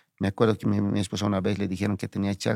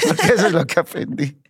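higher, louder, laughing through the words; laughing while explaining; 8 semitones higher, 5 dB louder than usual. Real, not acted. Telling the sister what he learned, laughing.

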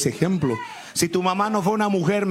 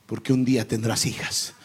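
solemn mother; 8 semitones lower than usual. Retold or imitated.

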